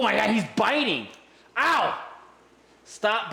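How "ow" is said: short high ow as the fish bites; real ow of pain. Real, not acted. Fish clamps on his thumb, a sharp pained ow. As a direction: yelp it out when the bite lands.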